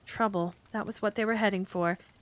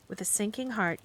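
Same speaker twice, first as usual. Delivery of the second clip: ordinary delivery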